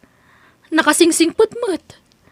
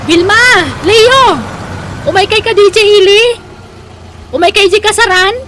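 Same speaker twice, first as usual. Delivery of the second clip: loud, higher call to people nearby; 4 semitones higher, 5 dB louder than usual. Acted. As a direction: call them over from a distance. Calling out to neighbors across street.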